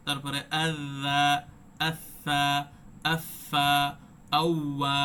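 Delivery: each letter after আ drawn out and never stopped; slow drill of আ plus each letter, every consonant held; held soft letters. Shown as a demonstration. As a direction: recite আখ, আশা, আফা slowly with the sound flowing on. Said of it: "একটাতেও কিন্তু sound কি হয়নি, আটকে যায়নি".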